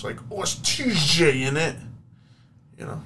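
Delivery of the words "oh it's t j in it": slow, raised British accent impression; loud hammed-up British slang voice. Shown as a demonstration. Showing he can do British, exaggerated British accent. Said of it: "I can easily do British".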